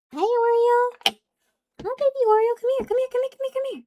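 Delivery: high sing-song pet voice. Real, not acted. Talking to the cat in a high baby voice. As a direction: coo at the kitten in a high voice.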